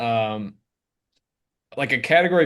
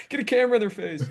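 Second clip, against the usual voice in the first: mock hyped chaser; 9 semitones higher, much faster than usual. Mocking chaser.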